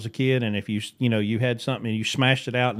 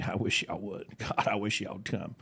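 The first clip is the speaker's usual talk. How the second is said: much softer wry inner thought; muttered aside; 4 semitones lower, 11 dB quieter than usual. Retold or imitated. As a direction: mutter it to yourself, half laughing. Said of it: "I'm thinking".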